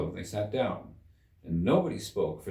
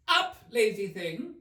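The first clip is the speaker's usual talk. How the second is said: high, slow, mocking royal scold; scornful Queen; 12 semitones higher than usual. A narrator's reading. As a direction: sneer at the sleeping griffin with a laugh. The Queen rouses the griffin.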